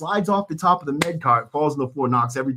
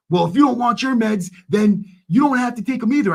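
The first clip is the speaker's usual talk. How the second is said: higher, mocking nurse voice; mock snippy nurse; 10 semitones higher, 4 dB louder than usual. Nurse snapping back at a prisoner, mocking higher voice. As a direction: sneer it like a petty nurse. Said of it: "she's like".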